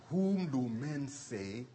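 half-speed, dropping to soft on "the Son of Man"; slow soft question. Retold or imitated. The Lord asking the disciples, slow and soft. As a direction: ask it slowly, fading almost to a hush.

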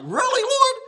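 can't believe what he just heard; a man stunned by God's answer, incredulous. Retold or imitated. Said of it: "I'm like".

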